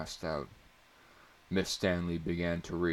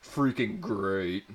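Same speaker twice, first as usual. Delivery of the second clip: slow, louder sarcastic outburst; 3 semitones higher, 6 dB louder, slower than usual. A narrator's reading. Student to teacher, slow loud sarcasm. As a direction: spit it out with sarcasm. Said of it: "sarcastically spouted".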